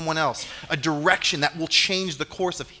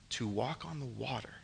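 near-whisper for emphasis; hushed; 4 semitones lower, 15 dB quieter than usual. Real, not acted. Preacher picturing Jesus calling you out of the boat, hushed. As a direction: drop to a hushed, breath-only voice. Dramatic hush at the climax.

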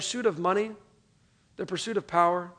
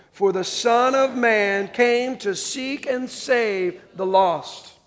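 very slow, measured unison reading; reading together; 2 semitones higher, much slower than usual. Shown as a demonstration. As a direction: drag each word so the room can read along. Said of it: "Read this last line with me".

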